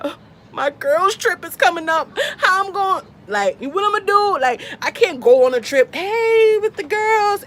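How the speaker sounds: retold teary fret; tearful whine. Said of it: "brought me to tears".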